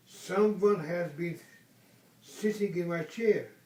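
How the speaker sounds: loud, booming papa bear; Papa Bear's great big voice. Acted. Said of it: "great big voice".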